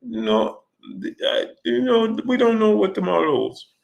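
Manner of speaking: high, halting, hesitant fighter's voice; Manny impression. Boxer dodging a question about an exhibition, halting Manny impression. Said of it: "Manny, what about".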